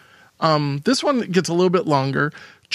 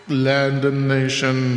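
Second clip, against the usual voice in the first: slow, low echoing repeat; 3 semitones lower, much slower than usual. Shown as a demonstration. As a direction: repeat it like a fading echo. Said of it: "People like the echo".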